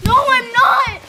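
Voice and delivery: high, very loud angry retort; angry shout. Acted. Hurt and asked if okay, angry shout. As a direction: shout back at the one asking. Hurt, snaps at worried question.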